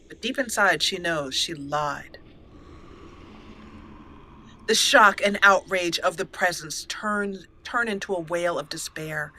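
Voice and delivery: usual speaking voice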